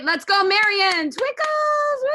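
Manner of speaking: high, rising hype call ending in a whoop; excited host whipping up applause; cheering on. Real, not acted. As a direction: shout them onstage with a soaring woo. Host cheers on next performer.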